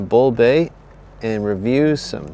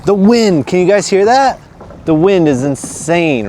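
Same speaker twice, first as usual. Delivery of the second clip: raised voice over roaring wind; shouting over wind; 7 semitones higher, 5 dB louder than usual. Real, not acted. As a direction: call out loud above the gusts.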